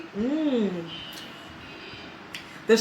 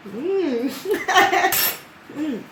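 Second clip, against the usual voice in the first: amused mm; 4 semitones higher, 3 dB quieter than usual. Real, not acted. Tasting salt, face knit up.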